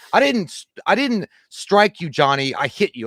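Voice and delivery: high, loud mock of the wife; mocking her voice. Mocking the wife's taped excuse to Johnny, high and loud. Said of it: "the bitch is literally on tape telling people".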